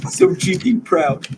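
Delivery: high, slow proud father; drawn-out proud father voice; slow proud dad. Acted. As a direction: savour your pride. GM plays the father.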